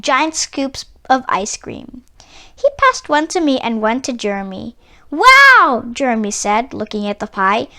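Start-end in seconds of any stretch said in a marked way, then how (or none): 5.1-5.8 loud, high delighted wow; amazed wow. Acted. Jeremy sees the giant pie slice, loud amazed wow. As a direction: gasp it out in wonder.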